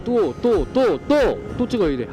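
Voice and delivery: venting at having to reshoot; frustrated. Real, not acted. Frustrated over lost footage. (짜증 섞인 목소리)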